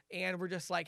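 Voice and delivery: ordinary talk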